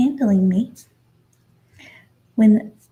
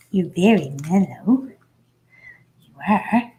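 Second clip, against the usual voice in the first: ordinary talk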